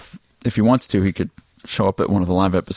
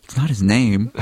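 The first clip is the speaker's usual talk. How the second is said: ordinary talk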